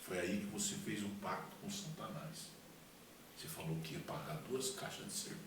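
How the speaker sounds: soft, low inner voice; Holy Spirit's voice. Retold or imitated. Holy Spirit shows him his pact, hushed and low. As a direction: quietly reveal the fault. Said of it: "a voz falou na minha mente, é o Espírito Santo falando".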